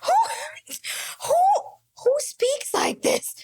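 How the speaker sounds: cracking up in disbelief; incredulous laughter. Real, not acted. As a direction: squeeze it out through helpless laughing.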